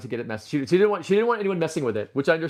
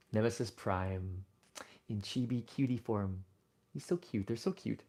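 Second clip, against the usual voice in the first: hushed slow cooing; soft cooing; 7 semitones lower, 12 dB quieter than usual. Real, not acted. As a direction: murmur fondly over cute toys. Cooing softly over toys.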